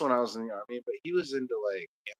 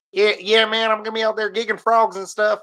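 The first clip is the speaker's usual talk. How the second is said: high, loud Southern buddy voice; mock excited hunting buddy; friend from Louisiana; 7 semitones higher, 4 dB louder than usual. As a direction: hype it up like a good old boy. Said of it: "he's like".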